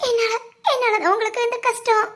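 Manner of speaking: tearful, breaking voice through sobs; crying. Acted. Wife alone, blaming herself for husband's accident, sobbing.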